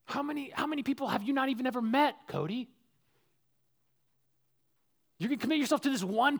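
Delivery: high, quick, anxious voice of doubt; a lightly voiced worried conscience; nagging inner voice. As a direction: let the doubt rise and rush. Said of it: "this little voice every once in a while".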